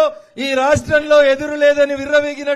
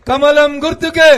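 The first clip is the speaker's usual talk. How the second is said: loud rally chant, calling to the crowd; slogan shout; 5 dB louder than usual. Real, not acted. Leading crowd in party slogan.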